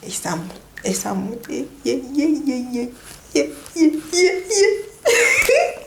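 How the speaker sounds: hushed start climbing into high "yeah, yeah" whimpers; mock pain whimper. Mother re-enacts contractions climbing, mock whimpering. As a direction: let the words dissolve into rising whimpers, ending in laughter.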